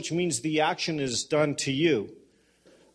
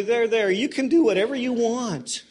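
higher, louder hammed-up indulgence; mock God giving permission, raised voice; lightly voiced permissive heavenly father; indulgent God, louder; 6 semitones higher, 7 dB louder than usual. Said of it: "He's gonna say".

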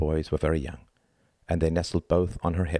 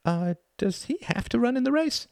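small, very high mouse voice; nervous. A narrator's reading. Mouse worried about Elephant joining, high nervous voice. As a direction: ask timidly, high and hesitant. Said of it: "Mister Mouse became a little nervous".

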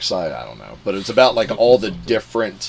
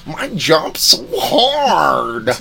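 slow, loud, high cartoon monster voice; Big Mouth monster voice; 6 semitones higher, 7 dB louder, slower than usual. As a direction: ham up a horny cartoon creature. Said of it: "catching up on Big Mouth".

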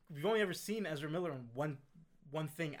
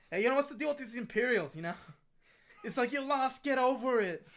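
loud, high stand-up comedian patter; stand-up comic; 8 semitones higher, 8 dB louder than usual. Pretend. Bill Burr doing stand-up at the Imperials, loud and high. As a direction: sell it like a Boston stand-up bit. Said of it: "going into a stand-up set".

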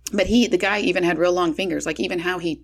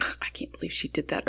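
breathy hushed grumble; whispered gripe; 12 dB quieter than usual. Pretend. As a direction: mutter the complaint under your breath. Imagined complaint about sister.

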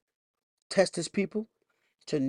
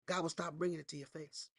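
dropped to a soft, quiet hush; softer take of the warning; 13 dB quieter than usual. Acted. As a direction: pull it right down, almost confiding. Same warning, dropped low.

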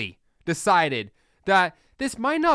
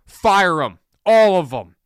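forceful command; 7 dB louder than usual. Real, not acted. Host demands Jalen Carter fire his whole camp, loud and forceful. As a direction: punch both short lines out loud and hard.

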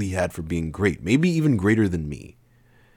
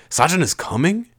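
high, puzzled question; confused; 11 semitones higher, 3 dB louder than usual. A narrator's reading. Narrator learning Sajin is coming, confused. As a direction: ask it in confusion.

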